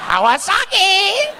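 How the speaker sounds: high, slow, laughing "Kawasaki."; mock the shy wife saying "Kawasaki."; mimicking wife. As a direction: stretch it out high while laughing. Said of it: "She was like".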